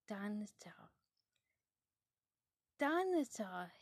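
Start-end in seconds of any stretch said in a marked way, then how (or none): 2.8-3.7 growled. Acted. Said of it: "he growled".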